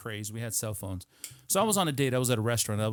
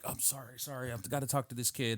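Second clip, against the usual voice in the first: much softer, murmured apology; hushed apology; 9 dB quieter than usual. Retold or imitated. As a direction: apologize under your breath at the table. Apologizing quietly to his date.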